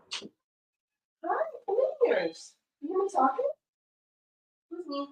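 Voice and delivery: squeaky pet-talk voice. Real, not acted. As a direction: coo at the cat in a tiny high voice. Baby talk to the cat.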